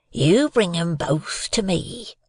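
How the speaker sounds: slow, emphatic, drawn-out order; menacing convict. A narrator's reading. Convict tilts the boy and gives orders, menacing. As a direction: draw out each order as a threat.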